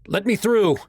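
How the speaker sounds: loud, high urgent shout. A narrator's reading. Son shouting at the fireman to reach his mom. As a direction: shout it at the man blocking you. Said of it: "I shouted at the fireman".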